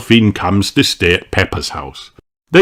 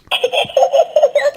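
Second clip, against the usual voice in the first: giggle. A narrator's reading. Peppa laughs.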